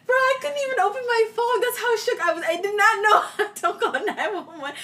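high, giggling while telling; real laughter breaking through; laughing through it. Real, not acted. Laughing retelling brother choking.

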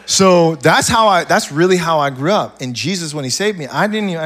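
usual speaking voice

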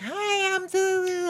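very high, squeaky cartoon voice; mock a hated recorded voice in squeaky falsetto; Minnie Mouse. Friend mocking how people hear themselves, squeaky cartoon voice. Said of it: "like fucking Minnie Mouse".

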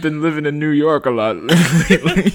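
a hammed-up New Yorker cracking up; caricature New York accent. New York [name] answering Chris's teasing in a laughing caricature New York accent. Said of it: "caricature of a New York accent".

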